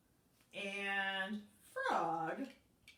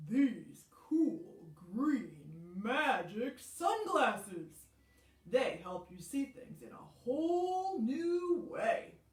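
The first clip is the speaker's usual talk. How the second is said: louder, slowed frog with a changed timbre; 5 dB louder, much slower than usual. Acted. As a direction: sell the magic sunglasses, slow and loud. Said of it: "And Frog said".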